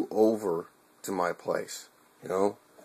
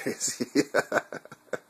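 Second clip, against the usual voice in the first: choked laugh; 5 semitones higher than usual. Real, not acted. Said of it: "getting a little emotional here".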